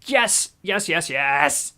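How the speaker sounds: loud jubilant yes, then drawn-out yeses; goal celebration. Real, not acted. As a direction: celebrate the penalty like it matters. Penalty scored, celebrating.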